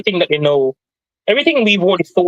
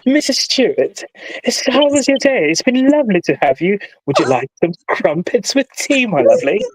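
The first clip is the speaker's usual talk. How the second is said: put-on posh British accent; 4 semitones higher than usual. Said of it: "you're going Bridgerton".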